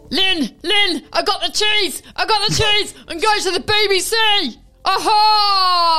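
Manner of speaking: Alan Partridge. Pretend. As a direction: crow it like a pompous presenter. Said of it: "Alan Partridge".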